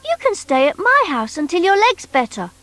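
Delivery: put-on high, bright little toy boy; Noddy's voice. Noddy asking the strange creature, high boyish voice. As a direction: pitch up as young Noddy.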